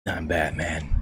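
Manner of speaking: low, hushed superhero growl; Batman. Acted. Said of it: "He's Lego Batman".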